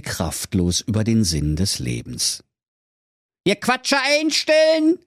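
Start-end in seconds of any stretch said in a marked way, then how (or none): 3.5-5.0 calling out. Acted. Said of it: "rief da der Schnitzelschorsch".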